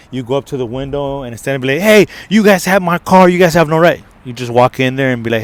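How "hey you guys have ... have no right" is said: loud, high-pitched confrontation; acted-out indignant outburst; angry complaint. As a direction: confront the clerks, loud and accusing. Wrong angry approach at the window.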